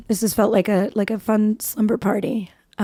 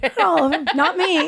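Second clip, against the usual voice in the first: laughing; 6 semitones higher than usual. Real, not acted. Joking denial amid laughter.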